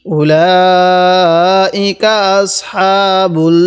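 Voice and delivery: slow, melodic Quran recitation, pausing between phrases; word by word recitation. Shown as a demonstration. Teacher recites the verse in pieces for students, slow tajweed chant. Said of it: "ভেঙ্গে ভেঙ্গে পড়ব".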